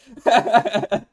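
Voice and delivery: a loud, high burst of laughter. Real, not acted. Streamer cracking up after his catchphrase, loud high laugh. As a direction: howl with laughter.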